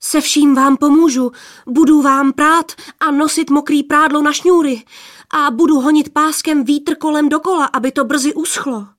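flustered, high-pitched promises; alarmed pleading. A narrator's reading. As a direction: bargain urgently, easing off at the end. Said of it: "lekl se poplašený mládenec".

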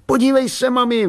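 put-on high, excited boy calling his mum; excited high-pitched call. A narrator's reading. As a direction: call for attention, pitched right up.

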